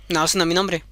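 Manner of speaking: low, smug refusal; the goblin rejecting the queen's guess. Acted. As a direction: answer low and unbothered.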